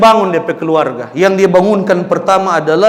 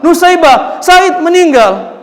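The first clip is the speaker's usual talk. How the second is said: loud announcement; 10 semitones higher, 4 dB louder, slower than usual. Acted. Messenger announces death.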